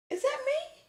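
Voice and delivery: high, hushed disbelief; shocked squeak. Retold or imitated. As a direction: squeak out the shock of seeing himself on her shirt. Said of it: "I said".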